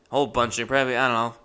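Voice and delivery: normal talking voice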